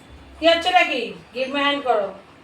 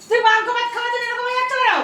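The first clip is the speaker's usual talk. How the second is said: much higher and fast, playfully exasperated; teasing exasperation; 10 semitones higher, faster than usual. Real, not acted. Owner asks the drooling dog why meat makes it like this, much higher and fast teasing. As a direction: tease the greedy dog with a squeaky high question.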